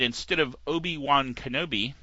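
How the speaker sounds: normal talking voice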